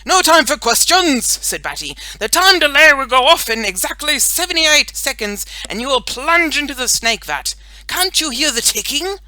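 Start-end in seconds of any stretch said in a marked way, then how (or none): 0.0-1.3 high, loud command; urgent superhero. A narrator's reading. Boy superhero cuts off girl's question, urgent. 2.2-9.2 high, loud, alarmed countdown announcement; urgent superhero warning. A narrator's reading. Boy superhero warns girl of snake vat, alarmed.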